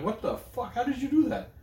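high incredulous what the fuck; baffled, pitch shooting up; mock disbelief. Reacting to mom's bone pile.

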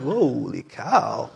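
slow, soft, drawn-out holy cow; mock disbelief under the breath; exasperated aside. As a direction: let it out like a weary sigh. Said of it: "I'm like".

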